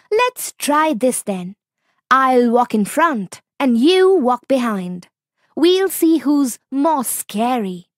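calm little fox staying cool; clever fox. Acted. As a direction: fox coolly refusing the tiger, high-pitched. Fox proposes the walk.

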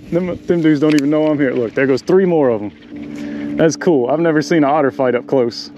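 ordinary delivery

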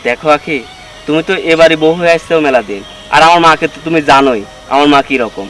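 low, soothing explanation; patient, low-voiced husband calming his wife; calm reassurance. Husband reasons with upset wife.

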